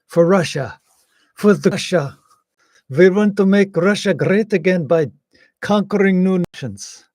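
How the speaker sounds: mock Russian accent. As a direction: ham up a Russian accent, speaking as Putin.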